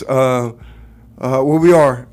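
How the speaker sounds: usual speaking voice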